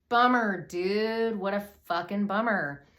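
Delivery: mock dismay. Real, not acted. Storyteller reacting to Jeff's only child appearing, dismayed. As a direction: burst out in comic sympathy. Exclaims at the awful twist.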